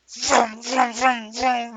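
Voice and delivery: loud revving engine noise, high; engine vroom. Retold or imitated. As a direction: rev it up like a racing car. Dog racing around garage.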